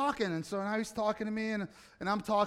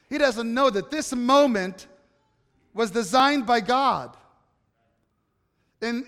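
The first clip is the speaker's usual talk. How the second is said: preaching at full force; 3 semitones higher, 4 dB louder than usual. Real, not acted. Pastor to his congregation, rising preaching fervor. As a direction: drive home God's design to the church.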